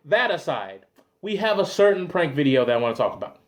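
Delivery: ordinary talk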